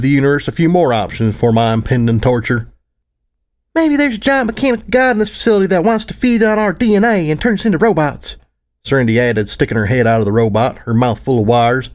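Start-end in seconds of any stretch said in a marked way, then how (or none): 3.7-8.4 put-on high eager little girl voice; excited filly voice. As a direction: gush like a thrilled young filly.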